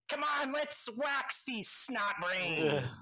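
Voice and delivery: very high, slow, gleeful rallying cry; gleeful gnome battle cry. Acted. Gnome rallying the villains to attack the heroes, very high gleeful cry. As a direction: relish the attack. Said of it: "and he's like".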